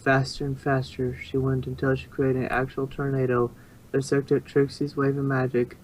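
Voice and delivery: normal talking voice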